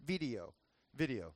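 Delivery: video in his Miami accent, low and soft; the Miami way he says video. Shown as a demonstration. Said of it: "My wife always teases me 'cause I say video".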